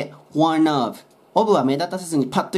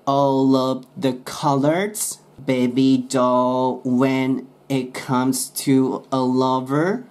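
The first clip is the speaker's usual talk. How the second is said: very slow demo reading; much slower than usual. Shown as a demonstration. Teacher slowly reading lyrics to show linking. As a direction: stretch each word, linking sounds. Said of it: "when ねでくっつけてもいいんですけど".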